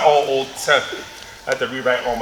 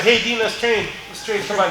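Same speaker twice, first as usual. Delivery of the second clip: raised-pitch, eager-sounding taunting invitation; acted goading; 7 semitones higher than usual. Training partner inviting Dean to train, mock-friendly goading. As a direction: call him over to train, imitating a rival.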